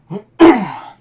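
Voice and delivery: loud harsh throat clear. Real, not acted. Streamer whose voice is going, loud throat clearing. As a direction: clear a tired, failing throat. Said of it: "my voice is starting to go".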